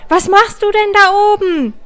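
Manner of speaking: startled teacher calling up in fright; alarmed shout. Acted. Teacher sees child on rings.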